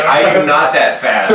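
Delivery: loud, high-pitched retold objection; mock protest. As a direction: protest it emphatically, half laughing at yourself. Retelling protest at demanding band ad.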